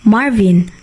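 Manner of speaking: usual speaking voice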